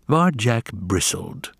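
ordinary delivery

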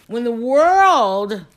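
emphatic slow build. Real, not acted. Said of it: "urging listeners to do what makes them happy".

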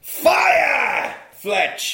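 mock dramatic shout; hammed-up dramatic shout; film trailer shout. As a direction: boom it out like a movie trailer. Retelling a film's dramatic opening.

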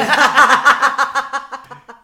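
high laugh bursting out; loud laughter. Real, not acted. Laughs at own joke.

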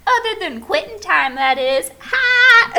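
high, loud mock driver joke; imitating the driver's joke; bus driver cheer. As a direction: cackle it like a chirpy driver. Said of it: "And she goes".

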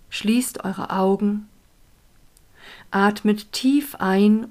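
calm, unhurried guiding voice; guided meditation. Acted. Leads a meditation.

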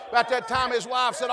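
usual speaking voice

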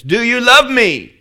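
loud, high, drawn-out cry; booming shout. Acted. Preacher booming the Lord's question at the congregation, shouted. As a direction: shout the question slowly and ringing.

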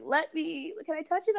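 put-on an older Korean woman asking sweetly to touch her hair; curious ajumma. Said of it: "Ajima would see my hair and be like".

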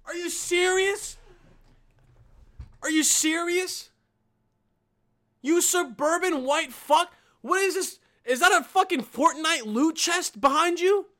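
high, loud ranting yell; shouting rant at a video; angry rant. Real, not acted. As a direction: tear into the screen, furious. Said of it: "I'm so sorry for getting that angry".

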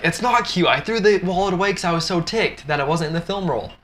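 hammed-up indignant complaint; exasperated [name]. [name] upset about thrown wallet.

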